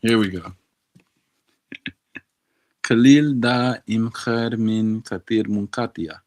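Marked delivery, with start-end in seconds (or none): 2.8-6.2 careful Arabic reading of the lyric; Arabic read aloud. Shown as a demonstration. Reading an Arabic lyric to his tutor, careful pronunciation. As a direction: sound out the Arabic words carefully. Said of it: "reads Arabic lyric aloud".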